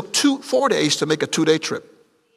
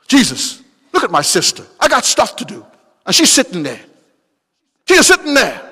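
indignant sister tattling, building louder and higher; arguing, complaining; 6 semitones higher, 5 dB louder than usual. Acted. Martha complaining to Jesus about her sister, indignant arguing. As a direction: complain with rising exasperation. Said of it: "that heart argues all the time".

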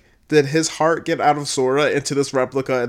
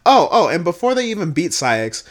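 normal talking voice

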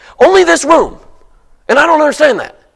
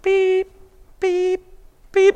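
high mock beeping like a backing truck; short, high, soft imitated truck beeps; truck reversing beeps; 9 semitones higher, 6 dB quieter, slower than usual. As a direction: beep like a garbage truck in reverse. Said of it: "the garbage truck is coming".

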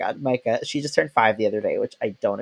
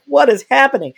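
high, loud tearful wail; imitating a bewildered, weepy outburst; mock crying; 13 semitones higher, 9 dB louder than usual. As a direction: wail it like a hammed-up crying fit. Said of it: "I started crying and I was like".

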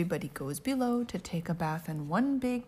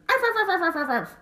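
high rapid arfs; high yappy arfs; 11 semitones higher, 4 dB louder, faster than usual. Acted. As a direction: yap like a toy dog. Small dog barking.

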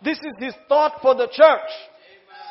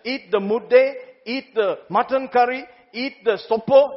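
usual speaking voice

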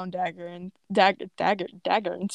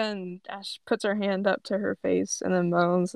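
normal talking voice

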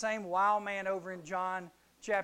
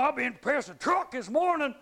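lightly voiced tough fisherman, louder and higher; tough guy; 6 semitones higher, 8 dB louder than usual. Preacher voicing big tough Peter, mock swagger. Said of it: "Big old tough fisherman".

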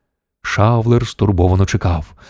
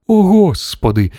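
high, drawn-out gasped exclamation; a dismayed slow cry; crying out in shock; 10 semitones higher, slower than usual. A narrator's reading. Shanks realises who is on the line, crying out. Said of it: "зойкнув Шанкс".

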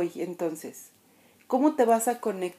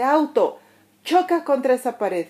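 higher, louder angry order to the car; mock angry command; 4 semitones higher, 5 dB louder than usual. Angry stranger ordering the parked car to crash, higher and louder. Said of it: "llega alguien enojado y le dice".

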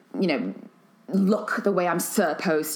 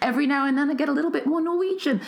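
quick, higher-pitched Nigerian accent; 10 semitones higher, faster than usual. Shown as a demonstration. Host shows how her accent slips, Nigerian accent. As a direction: slide playfully into a Nigerian lilt. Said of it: "I slide into a Nigerian accent".

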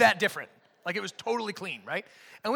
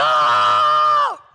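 high, loud whoop with a laugh; hyped bench cry; 12 dB louder than usual. Retold or imitated. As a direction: whoop like the bench after a dunk. Teammates reacting to a dunk.

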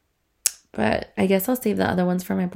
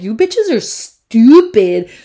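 slow, scornful, louder; scoffing boyfriend; 3 semitones higher, 9 dB louder, much slower than usual. Retold or imitated. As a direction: sneer it like a hater. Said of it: "my boyfriend's like".

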